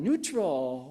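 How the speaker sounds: normal talking voice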